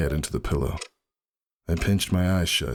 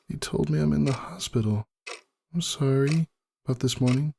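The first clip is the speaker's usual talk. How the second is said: higher, gentle sick mare; Roseluck's voice; 11 semitones higher than usual. A narrator's reading. Roseluck apologizes to Ember from her hospital bed.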